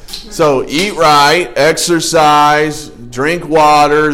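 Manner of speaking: slow, drawn-out mocking list; mock sing-song list. Shown as a demonstration. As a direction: stretch out each tired piece of advice. Listing advice slowly, mockingly.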